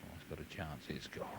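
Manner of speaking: low, soft tense murmur; hushed anticipation. Real, not acted. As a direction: hold your breath as the putt rolls. Tense hushed watching a putt.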